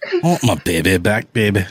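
hammed-up, swooping character voice; comic character impersonation. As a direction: play a broad cartoonish figure. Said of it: "bringing out the impersonations".

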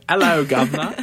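playful Cockney-style British greeting; mock British accent. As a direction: imitate a cheeky Brit saying hello. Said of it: "British people just being like that".

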